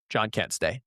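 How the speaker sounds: ordinary delivery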